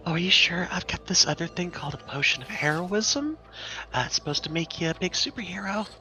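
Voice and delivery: high, coaxing shopkeeper voice; upselling potion seller. Acted. Seller pushing a potion of heroism, high coaxing voice. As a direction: tempt them in a high character voice.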